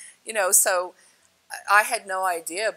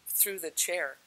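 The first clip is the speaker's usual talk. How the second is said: ordinary delivery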